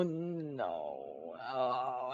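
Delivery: deep, groaning no; groaning despair. Real, not acted. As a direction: sink into a low groan of despair. Despair at phone update.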